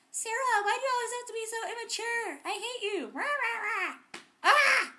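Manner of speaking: high, loud, whiny imitation of a sister; mock her shrieking, ending in a yell; mock screaming sister. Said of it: "Rebecca screaming my name".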